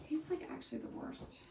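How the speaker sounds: unvoiced, soft aside to the camera; whispered joking complaint to viewers. Real, not acted. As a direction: confide it in a whisper. Aside to camera about him.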